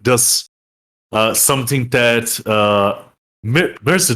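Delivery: ordinary talk, nothing marked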